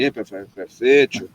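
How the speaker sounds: slow, low mocking "perfecto", drawn out; teasing imitation. Friend mocking him with his own word, slow teasing parody. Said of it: "still doing that to me when he meet me".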